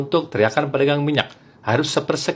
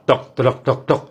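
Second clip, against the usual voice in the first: heavier noodle-cart knocking; 2 semitones lower, slower than usual. Retold or imitated. Noodle seller's bigger slit-drum, heavy knocking rhythm. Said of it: "Kalau lebih berat".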